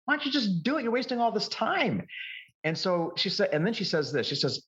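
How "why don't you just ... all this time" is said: mock exasperation at a husband, high-pitched; frustrated wife. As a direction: imitate a wife losing patience, voice raised in pitch. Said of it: "she was a little bit frustrated with him".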